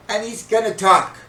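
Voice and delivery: high, loud alarm; mock panic. Older brother fearing the secret's out, loud panic. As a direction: relive the dread at full volume.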